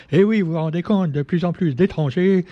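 lightly mock an old-fashioned alarmist commentator; Éric Zemmour impression. Vlogger mocking a pundit on immigration, lightly hammed-up old newsreel voice. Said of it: "certains, comme Éric Zemmour, diraient".

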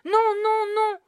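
repeated high, very loud screams; shrieked, insistent refusal; screaming boy. A narrator's reading. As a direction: scream each word like a child refusing. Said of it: "avait continué de hurler le petit garçon".

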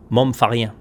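«moi, me fait rien» clipped fast; contracted local accent. Shown as a demonstration. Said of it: "par chez nous, c'est".

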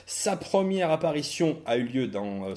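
normal talking voice

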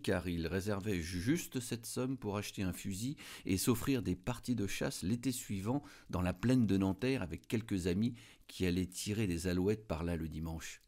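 ordinary delivery, nothing marked

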